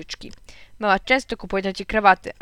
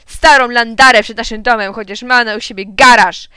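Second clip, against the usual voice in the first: angry rant; 2 semitones higher, 10 dB louder than usual. A narrator's reading. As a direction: rant at the rude neighbour. Angry father rants.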